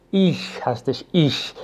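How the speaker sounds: "ایش" easy and soft, between sh and kh; relaxed soft sh. Shown as a demonstration. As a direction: say "ایش" with a relaxed, light final hiss. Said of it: "کاملاً راحت".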